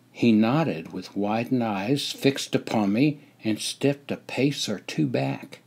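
usual speaking voice

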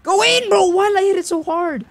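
high, loud exasperated yell; frustrated shout. Real, not acted. Misses a shot in the game.

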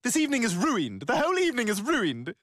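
"ruined" said as roo-ind, two syllables; odd pronunciation of ruined. Acted. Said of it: "Why are you saying it like that?".

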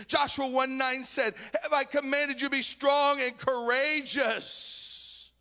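high, loud preacher's cry, driving scripture home; rally the church with every verse shouted out; preaching at full force. Acted. Preacher exhorting congregation to trust God, shouting.

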